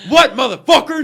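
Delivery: big man yelling back across the gas pump; mock tough yell. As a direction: bark it like a huge angry man. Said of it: "this behemoth of a fucking man goes".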